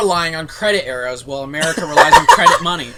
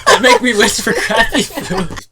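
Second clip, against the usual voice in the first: laughing; 9 semitones higher, 3 dB louder, slower than usual. Shown as a demonstration. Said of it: "Replace that with crappy food".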